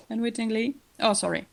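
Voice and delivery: ordinary delivery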